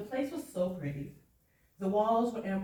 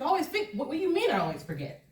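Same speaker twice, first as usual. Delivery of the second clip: higher, rising disbelief on always; incredulous echo; 6 semitones higher, much faster than usual. Retold or imitated. Dancer echoing her dad in disbelief, high rising incredulous. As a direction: repeat his words back in disbelief, then rush the question. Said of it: "I said".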